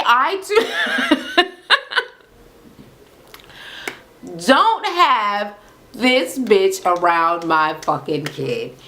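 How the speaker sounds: lightly voiced mother scolding her son, half laughing; imitating the mother.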